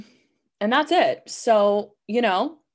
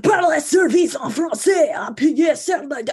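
shouted, much higher mock French prompt; aggressive French phone lady; 10 semitones higher, 7 dB louder than usual. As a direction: bark the menu in French like a threat. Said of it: "sound so aggressive and hostile".

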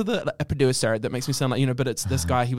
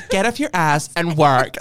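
slow, loud, laughing mock Kim; a hammed-up Kim line; Kim Kardashian impression; 7 dB louder, slower than usual. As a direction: drag it out, barely holding a laugh. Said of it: "And I'm like".

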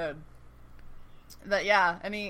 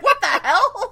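squealed through laughter, much higher; laughing What the hell; 10 semitones higher, 7 dB louder, slower than usual. Retold or imitated. Mohinder sees Nikki burst in, laughing shriek. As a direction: shriek it out giggling in mock shock.

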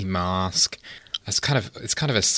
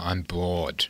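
bored; 6 semitones lower, 8 dB quieter than usual. Pretend. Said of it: "I'm snoring".

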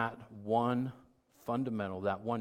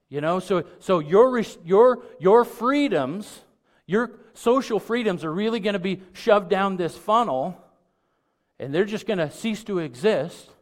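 loud and high, pounding the point home; preacher hitting the peak of the sermon, slowing on freedoms; preaching at full force; 11 semitones higher, 15 dB louder than usual. Acted.

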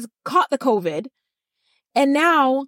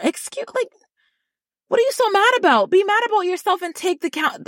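outraged disbelief; 7 semitones higher than usual. Real, not acted. Outraged question, much higher, fast.

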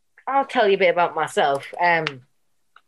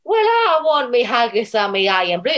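loud, high, badly faked Scottish accent; hammed-up Scottish complaint, pitched high; mock Scottish accent; 5 semitones higher, 3 dB louder than usual. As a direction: belt out a terrible fake Scotsman. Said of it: "probably a Scotsman listening to this and being like".

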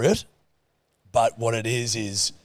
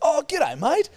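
put-on "Oh, g'day, mate" pitched way up, greeting an old pal; over-friendly inflection; 4 dB louder, slower than usual. Greeting a dude who approached him, "g'day, mate" high and over-friendly. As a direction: lift "g'day, mate" high, as if you know them. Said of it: "I did that inflection".

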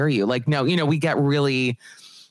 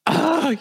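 high-pitched exasperated "ugh"; exasperated groan; 13 semitones higher than usual. Acted. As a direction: let out a pained, high groan. Exasperated groan about own people.

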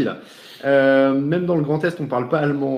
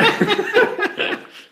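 a squealing, very high laugh; high burst of laughter. Real, not acted. Host cracking up at the fake German gibberish. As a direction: lose it completely at the joke.

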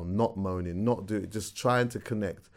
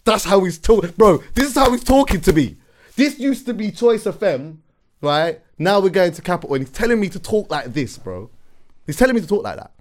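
loud, high-pitched exasperated rant; worked-up, incredulous outburst; real frustration; 10 semitones higher, 10 dB louder than usual. Real, not acted. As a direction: protest in disbelief, voice raised high. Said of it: "I feel the frustration".

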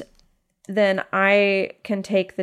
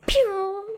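mouthed missile zing, pitched high; playful high-pitched deflection noise; high "pew" sound effect; 10 semitones higher than usual. Acted. Player miming a deflected missile, playful sound effect.

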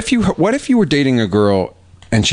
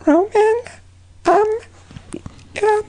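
put-on high girly voice.

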